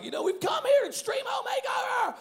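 high-pitched amped-up host announcement; Ty Penny impression, hyped. Imitating the host announcing the show, hyped shouting. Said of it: "he's like".

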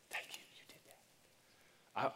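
slow, breathless whisper of gratitude; grateful leper whispering thanks to Jesus. Retold or imitated. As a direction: whisper it slowly, overcome.

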